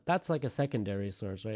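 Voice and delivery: ordinary delivery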